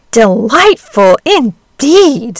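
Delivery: very slow, loud sarcasm; sarcastic. Acted. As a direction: drag it out mockingly. Hen mocks duckling's words.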